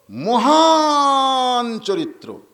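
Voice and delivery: loud, high, hugely drawn-out first word; preacher's exalted proclamation. Real, not acted. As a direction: cry it out and stretch the praise. Preacher proclaims the Prophet's great character. (উঁচু গলায় টেনে টেনে ঘোষণা)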